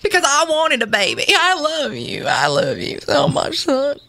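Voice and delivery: pleading Southern mama, acted; tearful-edged Southern melodrama; Southern accent. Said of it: "do this in a Southern accent".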